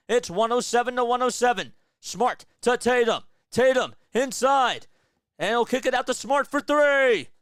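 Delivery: building from calm call to loud, high, fast excitement; live play-by-play. Acted. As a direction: call the final seconds like a broadcaster.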